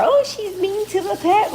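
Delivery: a high mock-whiny voice of critics. Mocking viewers who say she's mean, high whine. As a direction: mimic people scolding her, high and slow. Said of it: "people be going too far".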